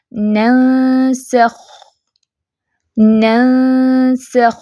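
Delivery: ننسخ with the final خ held long and breathy, very slow; sustained kh. Shown as a demonstration. Quran teacher showing students how to say ننسخ, slow drawn kh. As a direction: stretch the خ in ننسخ, letting breath flow. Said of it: "خا ساکن کی جیسے آواز بتائی ہے".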